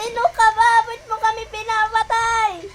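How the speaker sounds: loud demanding yell; angry shouting. Real, not acted. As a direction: shout at the monster. Confronting a monster in game.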